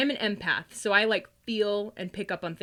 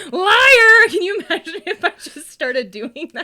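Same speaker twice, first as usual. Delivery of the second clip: very loud, high shrieked accusation, laughing; mock shout; 12 semitones higher, 16 dB louder than usual. Imagining calling out liars, shrieking with laughter. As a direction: scream it at the liar, half laughing.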